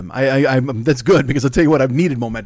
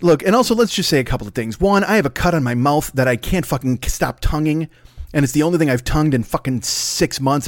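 ordinary delivery

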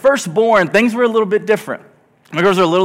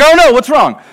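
high, loud acted alarm; mock panic; 7 semitones higher, 9 dB louder than usual. New dad jumping at his crying baby, mock panic. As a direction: imitate an over-alarmed new parent.

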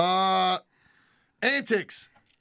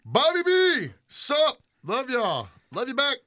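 excited shout-out; 9 semitones higher, 4 dB louder, much slower than usual. Real, not acted. Greeting fans from chat.

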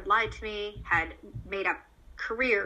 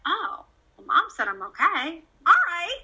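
higher, lightly voiced child accepting it; shrugging little kid; 7 semitones higher, 4 dB louder than usual. Child deciding they are okay, easily reassured. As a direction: perk up high at the end. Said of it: "they're like".

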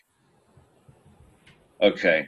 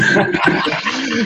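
real laugh; 13 semitones higher than usual. Real, not acted. Telling friends the story, bursting out laughing.

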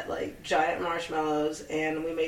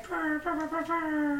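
purring; 8 semitones higher, 5 dB quieter than usual. Pretend. Cat purring sounds on podcast.

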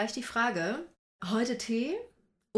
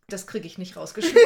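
laughing; 11 dB louder than usual. Real, not acted. Laughing at the cough.

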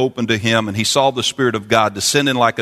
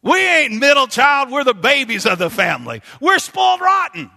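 shouting preacher. Acted. Preacher shouting to the church that we're God's babies. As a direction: shout it out with joy.

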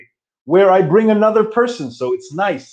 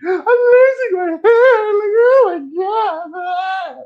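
much higher, slow, fake wailing sob; mock crying friend; 4 dB louder, slower than usual. Balding friend lamenting his hair, mock sobbing wail. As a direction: wail like a melodramatic crybaby. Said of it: "my friends who are balding".

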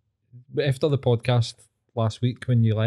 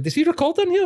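much higher starstruck question; excited fan; faster than usual. Retold or imitated. Host imitating the excited woman asking about the studio. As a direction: ask it in her excited fan voice. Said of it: "Then she was like".

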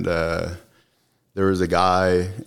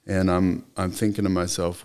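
ordinary delivery

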